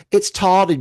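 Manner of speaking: "It's tardy" in a mock posh English accent, high and loud; hammed-up British "It's tardy". Shown as a demonstration. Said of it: "I have to say it in an English accent".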